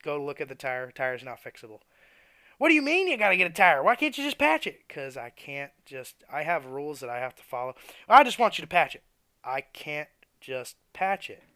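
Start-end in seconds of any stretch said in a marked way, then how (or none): 2.6-4.9 loud, high, fast demand from a pushy customer; angry customer. Retold or imitated. Customer demanding tire patch. 8.1-9.0 loud, high insistence; pushy customer. Retold or imitated.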